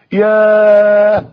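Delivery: one very long, held "ياه"; stunned sigh of realisation. Acted. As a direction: stretch it out as if struck to the heart. Struck again by second question. (ياه ممدودة من أثر الصدمة)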